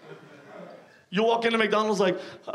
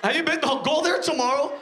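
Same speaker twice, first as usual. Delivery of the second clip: ordinary talk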